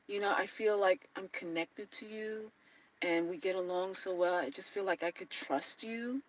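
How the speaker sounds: very soft, demure cooing; coy whisper-soft excuse. Acted. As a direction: murmur it like you mean it, all innocence. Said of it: "This is what you tell him".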